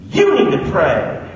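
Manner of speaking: high, slow, loud Southern granny voice; Granny Lee accent. Retold or imitated. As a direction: preach it like an old church grandma, slow and loud. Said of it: "I do this Granny Lee accent every time".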